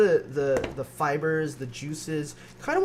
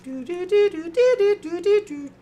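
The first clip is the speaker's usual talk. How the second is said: high, loud little melody of a microwave; sung microwave jingle; 8 dB louder than usual. Retold or imitated. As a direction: sing the chime like a kitchen appliance. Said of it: "Japanese microwaves are so musical".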